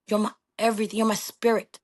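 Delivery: ordinary delivery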